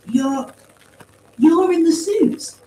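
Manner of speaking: high, slow, stammered question; incredulous demand. A narrator's reading. Paz confronts the voice inside the suits, incredulous demand. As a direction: stretch it out in disbelief, rising at the end. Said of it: "Paz demanded".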